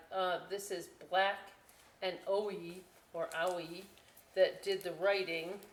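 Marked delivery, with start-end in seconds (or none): none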